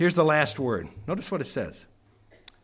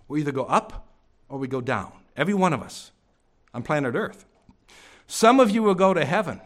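ordinary delivery

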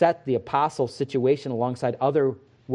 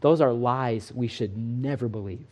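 usual speaking voice

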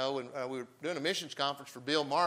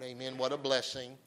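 ordinary talk

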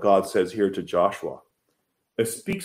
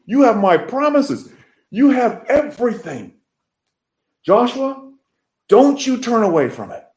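much higher and louder, impassioned plea; God's voice soaring; 12 semitones higher, 6 dB louder than usual. Acted. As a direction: cry it out as God urging Joshua. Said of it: "God says".

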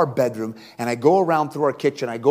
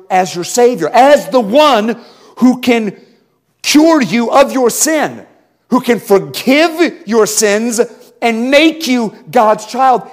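louder, higher preaching delivery; full-force preaching; 5 semitones higher, 5 dB louder, slower than usual. Acted. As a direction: preach it hard to the congregation.

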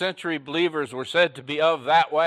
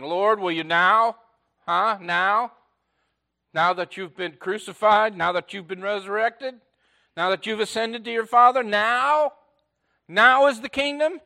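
building higher and louder with each now; eager pressing question; 6 semitones higher, 4 dB louder than usual. Acted. Disciples pressing Jesus for the kingdom, rising eagerness.

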